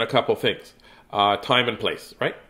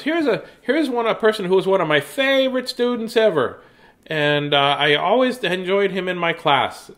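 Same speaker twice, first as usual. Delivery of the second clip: lightly voiced old teacher, pitched higher; a hint of an elderly teacher praising a student; old teacher's voice; 8 semitones higher, 4 dB louder than usual. Retelling the old teacher's introduction.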